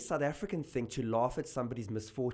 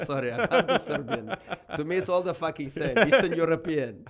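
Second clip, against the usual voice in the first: laughing through a hammed-up Russian accent; a loud, higher, jokey Eastern European put-on; mock Russian accent; 5 semitones higher, 8 dB louder than usual. Comic imitating a Serbian friend, in a mock Russian accent. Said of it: "I can only do Russian accent".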